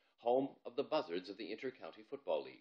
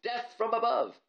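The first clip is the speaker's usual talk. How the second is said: higher, louder battle cry; mock manly shout; 13 semitones higher, 6 dB louder than usual. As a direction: shout it bravely. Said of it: "I gave a manly shout".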